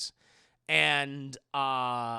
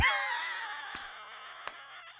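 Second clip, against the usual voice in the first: high squealing laugh; 3 dB quieter than usual. Real, not acted. Laughing at own joke.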